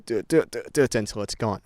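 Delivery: ordinary talk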